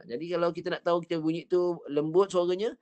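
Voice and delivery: ordinary delivery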